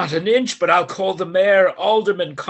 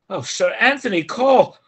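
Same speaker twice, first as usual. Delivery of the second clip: normal talking voice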